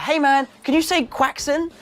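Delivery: imitated fan voice with "quackson" going up at the end; fan's request. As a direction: ask like a fan filming, "quackson" lifted as a question. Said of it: "they're like".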